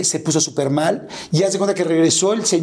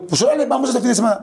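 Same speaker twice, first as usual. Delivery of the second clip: bright eager offer, pitched up high; eager agreement; 7 semitones higher, faster than usual. Retold or imitated. Son eagerly offering his father a weekend trip, pitched high. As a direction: jump at the chance to take him.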